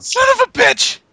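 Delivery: high-pitched exasperated curse; furious cursing. Real, not acted. Reviewer cursing the awful show, furious outburst. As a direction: swear at the end of your rope.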